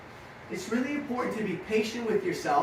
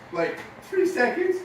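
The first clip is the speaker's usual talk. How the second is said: put-on young rider's voice; 8 semitones higher than usual. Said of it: "She goes".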